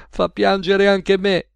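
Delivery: slow, high, mildly weepy voice; tearful. A narrator's reading. Cashier wiping her eyes at the broadcast, tearful. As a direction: drag it out as if moved to tears. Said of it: "asciugandosi gli occhi".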